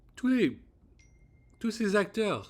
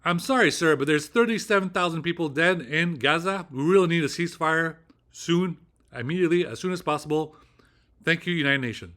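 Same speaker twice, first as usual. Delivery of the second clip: loud, emphatic official English; grave, weighty intonation; 4 semitones higher, 6 dB louder than usual. Shown as a demonstration. Phone message demanding a Gaza ceasefire, grave and emphatic. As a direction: stress the gravity, firmly and loudly. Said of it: "juste pour leur montrer la gravité de la situation".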